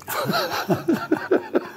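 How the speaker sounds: squealing laugh, pitch jumping way up; high squeal of laughter. Real, not acted. Laughs at the cat story.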